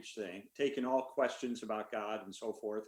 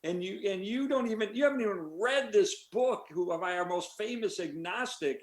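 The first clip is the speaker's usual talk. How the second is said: higher, indignant mock rant; retold angry outburst; 8 semitones higher than usual. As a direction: replay the heckler's outrage, pitch up. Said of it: "this guy got really mad".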